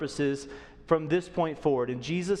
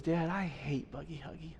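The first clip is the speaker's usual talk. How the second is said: much softer, a touch of tearfulness in the son's words; tearful son; 2 semitones lower, 9 dB quieter than usual. Retold or imitated. Tearful son repeating it to his dad, very soft. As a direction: quietly retell the boy's teary confession. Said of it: "his eyes start to well up".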